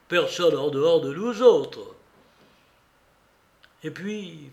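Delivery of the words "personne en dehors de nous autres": usual speaking voice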